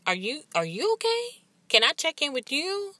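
imagined father asking her gently how she is; gentle check-in. Pretend.

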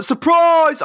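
high, loud mock yell of surprise. One arsehole spoiling the ambush, high loud yell. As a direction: jump out yelling it, high. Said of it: "that one fucking arsehole that's like".

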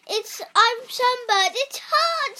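high, loud wailing cry; crying mermaid. A narrator's reading. Mermaid crying out to the flying doctors, high loud wail. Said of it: "she cried".

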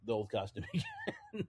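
breaking into a soft, high laugh; amused, voice giving way to laughter. Real, not acted. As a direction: let the end dissolve into quiet laughter. Amused by own costume theory.

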